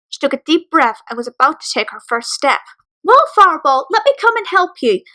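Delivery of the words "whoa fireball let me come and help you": raised, higher call from downstairs. Acted. Mother calling up to her daughter, a raised call. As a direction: call up the stairs to stop her. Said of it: "Sugar yelled from below".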